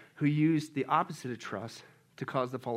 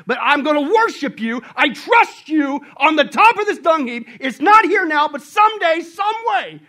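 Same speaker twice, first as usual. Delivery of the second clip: high, loud preaching cry; fervent cry; 11 semitones higher, 15 dB louder than usual. Acted. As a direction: proclaim trust at the top of your lungs. Preacher voicing Job's defiant faith.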